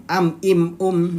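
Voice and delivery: normal talking voice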